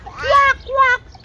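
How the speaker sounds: high-pitched imitation quacks; duck quack. Shown as a demonstration. Parent answers what the duck says, high quacking. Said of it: "What does the duck say?".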